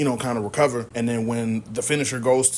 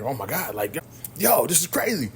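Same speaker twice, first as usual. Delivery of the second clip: mock fear; 4 semitones higher than usual. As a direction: retell a scared man's panicked reaction. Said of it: "like, in fear".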